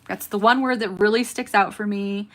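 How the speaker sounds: ordinary talk, nothing marked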